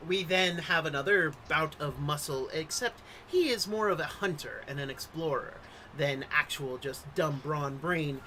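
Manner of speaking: ordinary delivery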